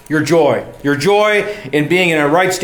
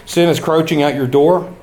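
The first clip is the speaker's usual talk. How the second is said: normal talking voice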